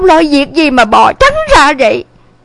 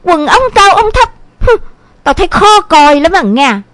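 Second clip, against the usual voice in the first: lightly acted nagging mother; scolding mother; 4 dB louder than usual. A narrator's reading. Mother scolds daughter over her rolled trousers, mildly reproachful. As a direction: tick off a daughter with mild reproach. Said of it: "ba mươi quở liền một câu".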